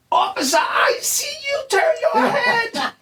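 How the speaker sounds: very high, drawn-out mock holler; stretched falsetto call-out; calling out across the street. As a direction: imitate a woman yelling across a street. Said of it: "bitch was calling me out from across the street".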